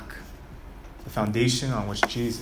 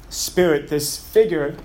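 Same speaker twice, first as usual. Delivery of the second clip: ordinary talk, nothing marked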